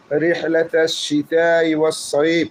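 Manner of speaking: slow chanted verse; slow Quran recitation; Quranic recitation. Shown as a demonstration. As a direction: recite the verse slowly with a rising end. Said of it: "recites the verse".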